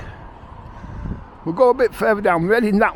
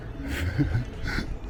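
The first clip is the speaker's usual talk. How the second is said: breathy chuckle; 10 semitones lower, 11 dB quieter than usual. Real, not acted. Joke about speed.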